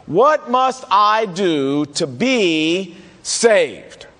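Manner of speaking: very slow, weighty, each word spaced out; solemn drawn-out jailer's plea; slow solemn quote. Acted. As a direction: let the question hang, drawn out. Quoting the jailer's famous question.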